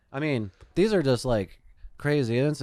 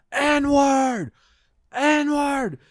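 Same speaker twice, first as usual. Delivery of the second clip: high, loud mock yell repeated twice; imitated screaming; 5 dB louder than usual. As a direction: shout it out like the man on the stairs. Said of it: "he is just screaming it".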